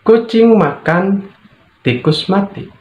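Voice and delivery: Kucing makan, then a pause before tikus mati, rising at both ends; pause after makan. Shown as a demonstration. Teacher showing intonation to the class, with a pause after makan. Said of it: "makna akan berbeda ketika intonasi berbeda".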